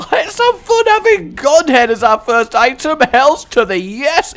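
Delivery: high, loud, jubilant whoop; excited celebration. Real, not acted. Gamer celebrating Godhead as first item, excited. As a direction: belt it out with delighted gusto.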